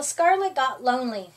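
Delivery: ordinary delivery, nothing marked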